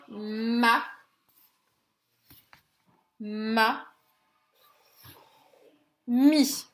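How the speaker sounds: "Ma, ma, mi" each said separately; long pauses between held "Ma", "ma" and "mi"; syllable by syllable. Shown as a demonstration. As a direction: sound out "Ma, ma, mi" for counting syllables. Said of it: "on va compter les syllabes".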